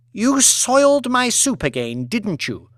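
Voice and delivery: high, cold accusation; accusing mistress. Acted. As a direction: corner the chef. Lady Elizabeth accuses head chef.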